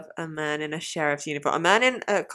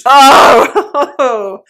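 loud, high "oh"; hammed-up swooning moan; 5 semitones higher, 12 dB louder than usual. Partner hearing he wears boots, loud swooning moan. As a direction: let out an exaggerated swoon.

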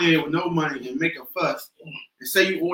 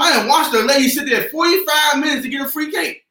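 telling it loud and worked up; excited shout; 6 semitones higher, 4 dB louder than usual. Real, not acted. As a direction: raise the voice, incredulous. Animated rant about customer.

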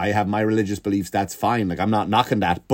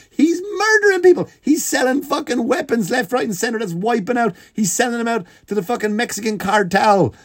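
outraged rant; 11 semitones higher than usual. Real, not acted. Comedian ranting that the arms dealer was traded, high and outraged. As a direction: rant at the absurd trade, fired up.

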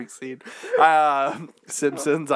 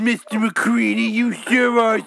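put-on eager kid voice; 7 semitones higher, 4 dB louder than usual. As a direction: gush like a wide-eyed child. Children admiring McCready.

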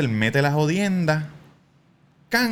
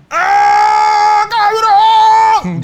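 much higher, louder scream of pain; 10 dB louder, much slower than usual. Retold or imitated. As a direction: scream as the liquid burns your ear. (grito de dolor fuerte y agudo)

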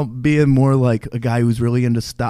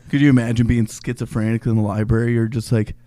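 usual speaking voice

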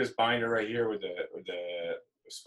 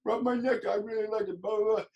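high, goofy voice for the dog; dopey voice. Retold or imitated. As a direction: talk as a dim-witted pet. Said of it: "They do this, like, dopey voice".

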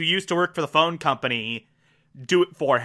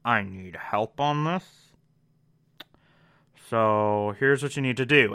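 a hint of a low, hushed Olivia; lightly voiced, lowered agent asking for help; voicing Olivia; 5 semitones lower, 3 dB quieter than usual. Olivia quietly asking Charlie for help, lightly voiced. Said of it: "Olivia pulls Charlie Francis aside and is like".

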